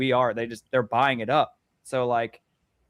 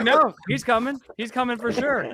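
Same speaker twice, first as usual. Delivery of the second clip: high, giggling reassurance; laughing while insisting the friend is definitely coming; laughing fib; 9 semitones higher, 3 dB louder than usual. Retold or imitated. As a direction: laugh through an obvious lie.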